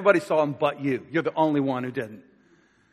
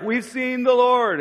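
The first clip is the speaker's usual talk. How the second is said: high, louder, slow proclaiming; excited announcement; 8 semitones higher, 6 dB louder, slower than usual. Retold or imitated. Disciples telling Thomas, high and triumphant.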